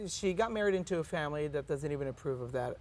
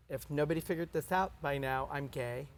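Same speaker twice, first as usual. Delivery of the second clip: ordinary talk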